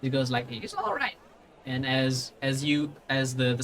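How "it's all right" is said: high-pitched little halfling voice; older halfling. Acted. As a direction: reassure in a small, high halfling voice. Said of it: "a smaller, like, older looking".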